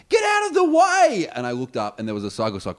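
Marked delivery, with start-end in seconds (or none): 0.1-1.3 high, loud, slow yell; retold shout. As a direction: shout a warning at a walker in the way. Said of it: "I just heard this".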